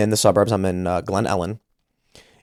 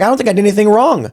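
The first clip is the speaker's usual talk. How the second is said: much higher and louder, fast indignant "I don't think I did anything wrong."; indignant protest; 11 semitones higher, 5 dB louder, faster than usual. Retold or imitated. Venting at home about the prosecutor, high fast indignation. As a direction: fire it off high and fast, outraged.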